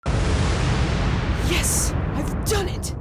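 exultant outburst; triumphant shout. Acted.

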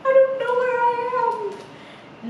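high, slow singsong mouse wail; tiny bewildered mouse voice; poor lost mouse. A narrator's reading. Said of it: "the poor mouse is like".

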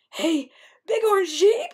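high, loud, drawn-out call. A narrator's reading. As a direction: hail someone far up the mountainside. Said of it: "he called out".

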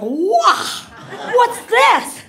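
high, loud mock astonished cry; a monkey stunned to find its legs straight; monkey surprise. Monkey standing upright for the first time, loud surprised yelp. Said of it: "he just, like".

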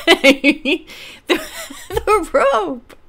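high, giggly retake; laughing. Real, not acted. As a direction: barely get "the rope" out through laughter. Retries word through laughter.

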